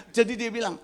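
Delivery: ordinary delivery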